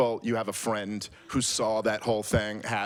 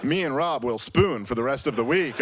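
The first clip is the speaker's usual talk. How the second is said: higher, sarcastic pushover voice; mock caving; 4 semitones higher, 4 dB louder than usual. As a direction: pretend to be tempted by the money. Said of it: "I was gonna be like".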